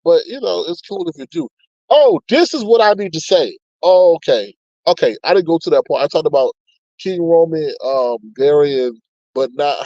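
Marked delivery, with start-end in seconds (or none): none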